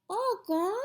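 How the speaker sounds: high, small questioning voice; toddler asking. A narrator's reading. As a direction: ask it like a tiny child. Toddler asks again.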